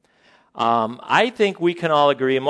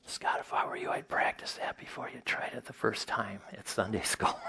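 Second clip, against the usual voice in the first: hushed, whispered private advice; confidential whisper; 11 dB quieter than usual. Retold or imitated. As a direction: lean in and whisper discreetly. Pastor's private word to him, retold.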